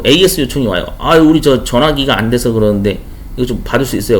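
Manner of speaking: ordinary talk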